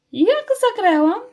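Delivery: high, loud, hopeful; eager mouse question. A narrator's reading. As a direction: ask for praise eagerly. Mouse after faked death.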